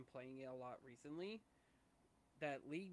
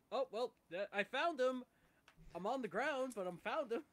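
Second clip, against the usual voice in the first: high, loud mock panic; hammed-up panic while being sniped; 8 semitones higher, 9 dB louder than usual. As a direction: yelp it, higher and louder throughout. Retelling getting sniped, mock panic.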